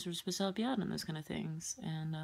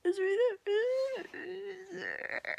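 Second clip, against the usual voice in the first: stretched wail; 5 dB louder, much slower than usual. Real, not acted. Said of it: "look at this".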